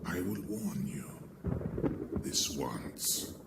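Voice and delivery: soft, unvoiced villain's warning; menacing whisper. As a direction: breathe the warning like a curse.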